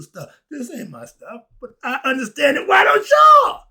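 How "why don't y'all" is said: shout. Real, not acted. Preacher calling out to audience.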